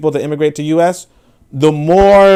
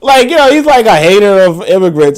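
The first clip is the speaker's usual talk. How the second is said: talking through real laughter, louder; laughing; 8 semitones higher, 7 dB louder than usual. Real, not acted. Laughing at the hater's accent compliment, speaking through laughter. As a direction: let the laugh carry the words.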